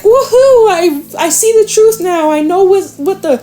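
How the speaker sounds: high-pitched mock celebration; hammed-up woohoo excitement; elated whoop. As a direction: act out a joyful breakthrough, voice way up. Acts out a joyful moment of clarity.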